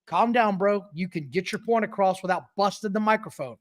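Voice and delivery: ordinary delivery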